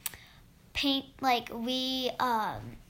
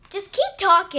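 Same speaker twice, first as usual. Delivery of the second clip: urging; 7 semitones higher, 12 dB louder than usual. Real, not acted. Urging sister to keep going.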